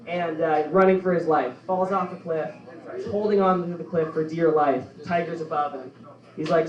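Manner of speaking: ordinary talk, nothing marked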